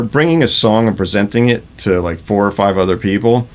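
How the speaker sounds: ordinary talk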